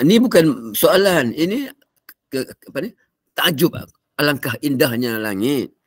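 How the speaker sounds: father correcting son. Retold or imitated. Father telling his son it was wonder, not a question. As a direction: spell it out to the boy patiently. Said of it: "dia beritahu anak".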